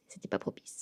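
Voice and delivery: whispered repeat. Real, not acted. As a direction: breathe it as a whisper.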